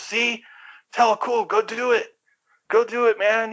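high-pitched, eager urging; egging him on. Retold or imitated. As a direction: imitate a friend pushing him into a dare. Friend urging him on, acted out.

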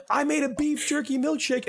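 very high-pitched cartoon mother; hammed-up high mom voice; mock anime mom. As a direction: put on a corny dubbed mom voice. Acts the mom in dubbed film.

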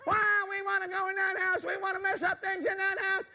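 little devils protesting to the boss, high and pushy. Retold or imitated. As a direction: argue back like a bratty little devil. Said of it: "they got all excited and said".

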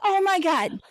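high thrilled squeal of a mom; a mom overjoyed to hear who is coming; excited mom. Retold or imitated. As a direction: gush like a delighted mother. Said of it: "She's like".

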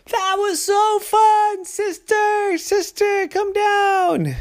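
put-on high, slow thrilled child calling; a high little boy calling his sister, thrilled; thrilled shout. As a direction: call up, overjoyed. Ryder thrilled after the slide.

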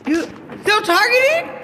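mock them with a high drawn-out taunt; scornful high-pitched taunt at detractors; mocking disbelief. Real, not acted. Mocking question in rant.

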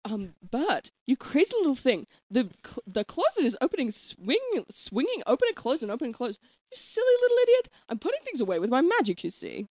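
lilting, swooping nanny; exaggerated up-and-down melody; Mary Poppins. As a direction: chide sweetly in sing-song. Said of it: "I'm Mary Poppins".

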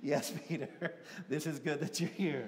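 amused, laughing through the words; teasing Peter with a chuckle; laughing mock reply. Preacher voicing Jesus answering Peter, laughing.

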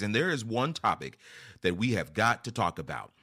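usual speaking voice

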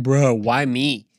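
drawn-out, put-upon groaning plea; slow, hammed-up self-pity; mock complaint. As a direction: drag it out like a reluctant helper. Voicing own reluctant complaint.

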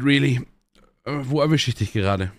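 ordinary delivery

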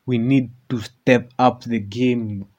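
usual speaking voice

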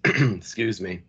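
ordinary delivery

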